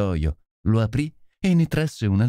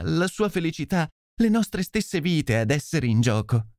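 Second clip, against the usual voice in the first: higher, pleading voice of a frightened lady; anguished noblewoman; 6 semitones higher than usual. Acted. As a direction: beg the detective for help, voice raised high. Lady Hilda's anguished confession. (nobildonna angosciata che implora aiuto)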